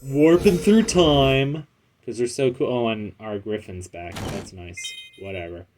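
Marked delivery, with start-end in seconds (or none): 0.0-1.7 dramatic. Acted. Dramatic game moment.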